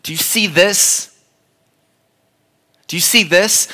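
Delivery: rising, insistent calls of hey, do you see this; urgent insistent Jesus. Acted. Jesus urging Simon to look, rising insistence. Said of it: "Jesus says".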